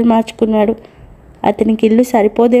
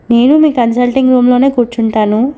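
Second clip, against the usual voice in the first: ordinary delivery, nothing marked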